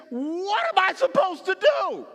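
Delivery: a loud anguished plea to heaven; desperate cry. Acted. Moses crying out to God at the Red Sea, desperate shout. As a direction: shout it high and slow in despair. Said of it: "And he says".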